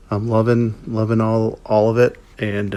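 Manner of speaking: usual speaking voice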